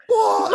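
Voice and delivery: big high-pitched mock whoa; Cosby Kids whoa. Acting out friends' reaction to the picture, loud whooping whoa. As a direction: burst out like a stunned kid. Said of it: "like the Cosby Kids".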